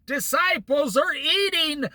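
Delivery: high, slow shouted accusation; mock shout. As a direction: yell it like a running, pointing Pharisee. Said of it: "running towards Jesus and saying".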